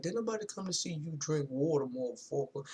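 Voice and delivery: lightly imitated comedian's punchline; Martin Lawrence. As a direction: toss off a quoted stand-up bit. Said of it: "He was like".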